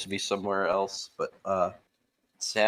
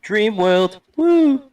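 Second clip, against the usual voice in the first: hype voice; 12 semitones higher, 8 dB louder, much slower than usual. Pretend. Hyped echo of game name.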